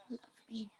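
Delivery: soft unvoiced whisper to pet. Real, not acted. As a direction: breathe it tenderly. Whispered to cat.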